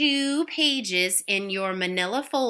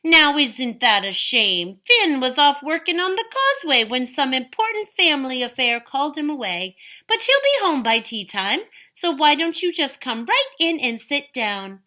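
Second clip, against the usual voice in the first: sweet smile; 6 semitones higher, 5 dB louder than usual. Acted. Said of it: "with her sweetest smile".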